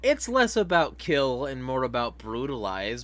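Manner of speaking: ordinary talk, nothing marked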